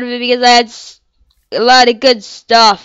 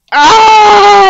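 frustrated cry; 8 semitones higher, 7 dB louder than usual. Real, not acted. Frustration at dying.

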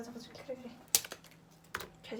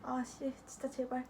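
cursing under breath; 10 dB quieter, slower than usual. Real, not acted. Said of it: "야, 욕하지 말라고".

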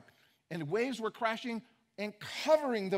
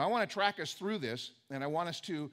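ordinary talk

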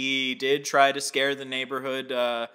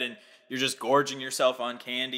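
usual speaking voice

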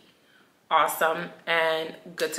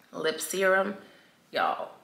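ordinary delivery

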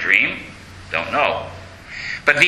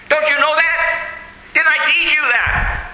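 higher, pointed rebuke, as to a pupil; scolding; 11 semitones higher than usual. Acted. Francis scolding Brother Angelo for driving off robbers, higher pointed rebuke. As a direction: drive the lesson home, teacher-like.

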